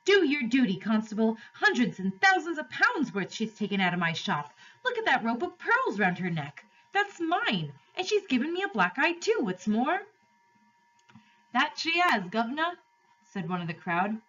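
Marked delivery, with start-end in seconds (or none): 0.0-10.0 high-pitched outraged shopkeeper; indignant fat shopkeeper, complaining. Acted. 11.5-12.8 lightly voiced higher-pitched onlooker; a hint of a cheeky bystander; onlooker in the crowd.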